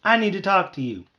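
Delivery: high, loud demand; yelling. A narrator's reading. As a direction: call out with a little voice at full volume. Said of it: "yelled at the top of my little voice".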